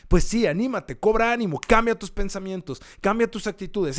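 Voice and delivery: high, loud rallying; impassioned preacher's push; fired-up exhortation. Real, not acted. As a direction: urge them on insistently.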